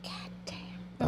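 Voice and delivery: unvoiced whisper of dismay; whispered curse. Young mom learning she is pregnant, whispering a curse. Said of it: "I was like".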